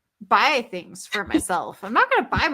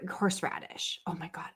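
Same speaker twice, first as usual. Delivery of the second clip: soft breathy whisper, much softer; whispered awe; 4 semitones lower, 11 dB quieter than usual. Real, not acted. Reacting to her cohost's horseradish, whispered. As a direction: hush it like a sacred word.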